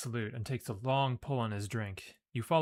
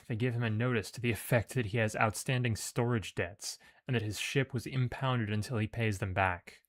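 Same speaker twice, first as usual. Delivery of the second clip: usual speaking voice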